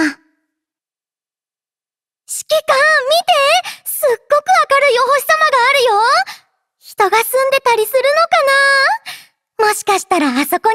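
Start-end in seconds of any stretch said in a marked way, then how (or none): 0.0-6.0 high, bright call to look; thrilled, pitched-up look over there; excited pointing. Acted. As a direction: point out the star with delight. Spots a bright star.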